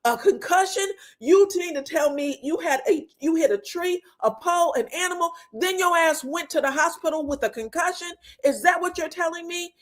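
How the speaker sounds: high-pitched disbelieving questioning; retold incredulity. Retelling Martell questioning the concussion story, high and incredulous. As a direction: pile up the questions in mock disbelief.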